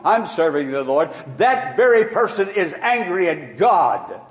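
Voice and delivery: preaching. Acted. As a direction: preach with rising force to the congregation. Preaching on suffering.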